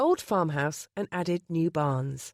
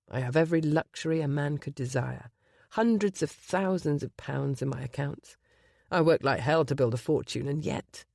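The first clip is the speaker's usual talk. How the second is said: low husky voice; 4 semitones lower than usual. Acted. Gabriel to Penny before the wedding, low husky confession. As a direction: lay bare his past in a low voice. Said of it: "his low, husky voice".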